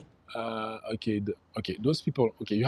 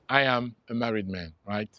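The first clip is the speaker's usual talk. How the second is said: normal talking voice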